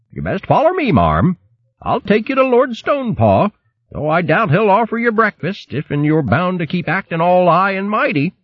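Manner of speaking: put-on bold old boxer calling out; gruff old hare. Hare speaks to cloaked figure.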